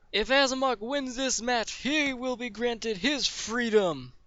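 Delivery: high, proclaiming announcer voice; arena announcer. Acted. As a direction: proclaim the stakes to the crowd. Announcer declares stakes of the fight.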